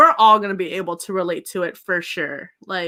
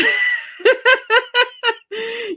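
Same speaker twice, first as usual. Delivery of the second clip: high laugh; 13 semitones higher than usual. Real, not acted. Laughing with live chat viewers.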